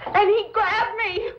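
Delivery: reliving the attack and falling apart in tears; crying out the memory, pitch rising; breaking into sobs. Acted.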